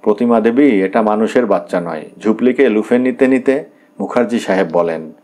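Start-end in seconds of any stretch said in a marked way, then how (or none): none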